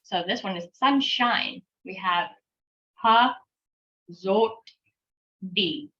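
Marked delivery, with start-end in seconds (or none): 3.0-5.9 slow teacher's sounding-out of "ha, zot, di"; syllable by syllable. Shown as a demonstration. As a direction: break it into ha, zot, di with long pauses. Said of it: "when you say it fast".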